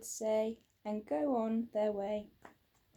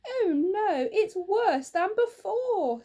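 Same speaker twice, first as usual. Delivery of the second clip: high, loud and slow dismay; distressed wail; 9 semitones higher, 7 dB louder than usual. Acted. Wife wailing to her husband about the rock. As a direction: wail it as if near tears.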